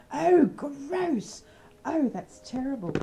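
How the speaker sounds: high, loud cry of revulsion; scandalised outburst, pitched right up; loud disgust. Acted. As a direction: recoil in horrified disgust. Reacting to a crude joke.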